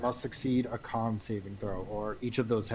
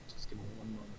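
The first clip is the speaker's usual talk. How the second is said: whisper; 9 dB quieter, much faster than usual. Real, not acted. Aside while checking item sheet.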